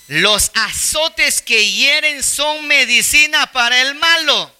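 slow reading aloud. Shown as a demonstration. Preacher reads the verse slowly to the congregation. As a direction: read the verse slowly and solemnly. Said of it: "Lean conmigo ese veinte treinta".

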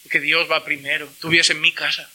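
put-on a father telling his child he will go to church; firm father. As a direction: settle it calmly as dad. Father ruling over child.

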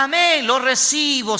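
high, drawn-out mock congregation cheer; grito eufórico imitado. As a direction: mimic a fervent church, stretching it high. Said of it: "gritan aforadamente". (imitación aguda y alargada de fieles eufóricos)

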